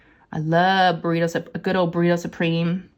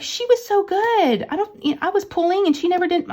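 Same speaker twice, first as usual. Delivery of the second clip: high-pitched, mock-amazed hairdresser; imitated amazement; 12 semitones higher than usual. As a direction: retell her astonishment in a much higher voice. Amazed hairdresser quoted afterwards.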